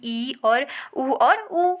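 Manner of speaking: ई and उ drawn out very slowly, each held; slow vowel demo. Shown as a demonstration. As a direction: hold ई and उ with a nearly closed mouth. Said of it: "उसे बोलते हैं संवृत".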